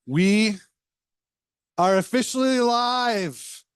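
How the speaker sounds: high, slow, booming show opener; hyped announcer. Acted. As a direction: announce it like going on air. Opening the live podcast broadcast.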